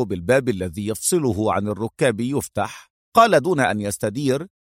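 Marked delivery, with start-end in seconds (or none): none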